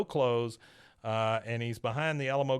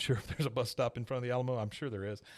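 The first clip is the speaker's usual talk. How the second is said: usual speaking voice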